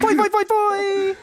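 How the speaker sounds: high, slow hype chant; hype yell. Pretend. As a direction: whoop it up like a hype man. Hype shout.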